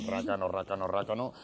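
low, soft teasing grumble; mock grumble. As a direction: mutter it like a disapproving aside. Mocking stingy bet. (refunfuño burlón)

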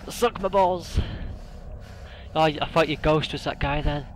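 ordinary delivery

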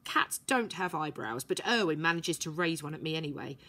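plain reading voice

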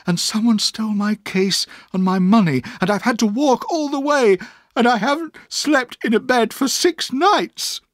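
desperate pleading. A narrator's reading. Exhausted boy begs his aunt for help, desperately. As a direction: let it tumble out, near breaking.

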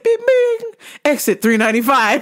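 high bing chime then robotic announcement; mock navigation voice; GPS voice. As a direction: imitate the GPS chime and prompt. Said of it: "it said".

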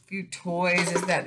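usual speaking voice

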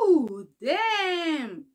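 sudden loud cry of dismay; startled cry. Real, not acted. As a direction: yelp as the drink spills. Drink bursts over her.

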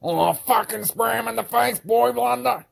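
high, loud urgent cheering; excited shout. Acted. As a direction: egg the sidekick on. Hero urges sidekick in fight.

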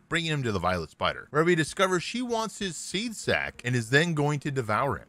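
ordinary talk, nothing marked

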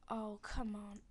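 ordinary talk

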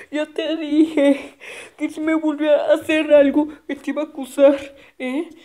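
high, loud, fast whine; a kid crying out that he'll tell his mom; whiny tattling kid. Acted. Hurt kid threatens to tell mom, high whiny.

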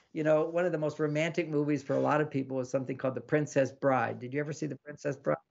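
ordinary delivery

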